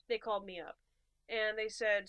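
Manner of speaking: usual speaking voice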